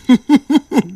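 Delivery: short chuckle at the caller; amused laugh. Real, not acted. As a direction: laugh mid-protest, amused.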